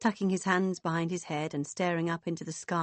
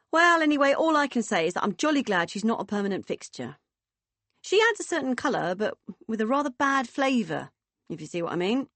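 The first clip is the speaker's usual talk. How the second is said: high, hearty disdain, easing off at the end; booming, disapproving woman; 5 semitones higher, 4 dB louder than usual. Acted. Woman glad the girl isn't staying, disdainful. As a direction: open loud, then settle into arch disapproval.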